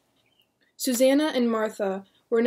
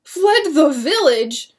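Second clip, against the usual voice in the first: high, loud, slow shocked cry; disbelieving cry; frantic cry; 6 semitones higher, 11 dB louder, slower than usual. Acted. William hearing the brothers fled, frantic cry. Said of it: "William cried frantically".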